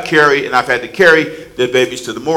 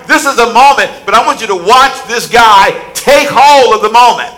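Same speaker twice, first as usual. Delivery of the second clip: higher, louder, building fervent sermon; preaching at full force; 6 semitones higher, 5 dB louder than usual. Acted. Preacher rousing the congregation at the climax, full force. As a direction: drive it home with rising fervour.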